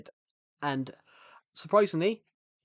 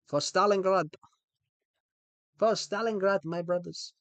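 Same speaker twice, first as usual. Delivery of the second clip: raised Russian-accented rallying cry; 6 semitones higher, 4 dB louder than usual. Shown as a demonstration. Rallying brothers for Stalingrad, higher Russian accent. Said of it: "do a Russian accent".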